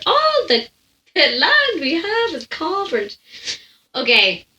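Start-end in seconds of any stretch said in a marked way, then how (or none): 0.0-3.2 higher, slow odd put-on voice; a mock quote in a strange voice, drawn out. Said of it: "I sound like".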